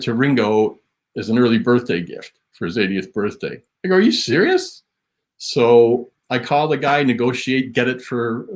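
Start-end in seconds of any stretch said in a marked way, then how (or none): 3.9-4.8 higher-pitched disbelieving question; incredulous. Retold or imitated. Teller quoting his own reaction to the gift plan, incredulous. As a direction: ask it as if you can't believe it.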